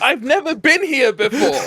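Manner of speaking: high laugh breaking through the words; giggly disbelief. Real, not acted. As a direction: laugh it out, delighted. Laughs at own bluff.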